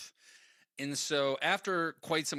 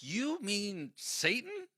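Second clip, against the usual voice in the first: slow, high-pitched disbelieving question; incredulous realization; 8 semitones higher, much slower than usual. Retold or imitated. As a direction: draw it out as it dawns on you. Acted slow realization in retold exchange.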